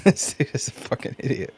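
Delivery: unvoiced, breathy mock grumble through a laugh; muttering as the viewer; imitated exasperated viewer. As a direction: mutter it like the viewer losing patience. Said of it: "It's like".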